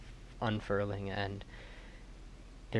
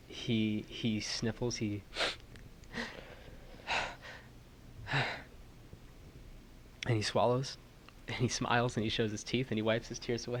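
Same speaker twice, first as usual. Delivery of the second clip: ordinary talk, nothing marked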